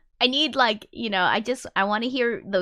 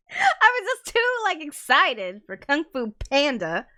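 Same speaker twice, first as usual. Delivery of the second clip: laughing through the words, higher; 9 semitones higher than usual. Real, not acted. Streamer laughs about neighbor's noise complaint, giggling. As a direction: giggle it out, still cracking up. Laughing at her own TV story.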